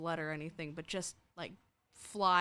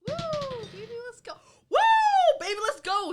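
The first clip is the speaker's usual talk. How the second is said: high-pitched victory cheer, building louder; excited whooping; 13 dB louder, much faster than usual. Real, not acted. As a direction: whoop and cheer the dice roll. Celebrating a high dice roll.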